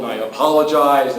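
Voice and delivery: usual speaking voice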